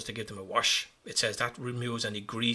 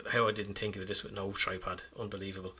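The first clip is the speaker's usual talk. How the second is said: usual speaking voice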